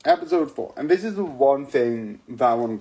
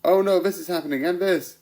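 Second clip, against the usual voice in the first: mock panic; 6 semitones higher, faster than usual. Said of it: "like, a".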